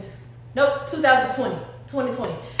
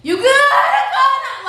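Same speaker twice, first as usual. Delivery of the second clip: retold scream; 10 semitones higher, 5 dB louder than usual. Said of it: "I'm literally screaming".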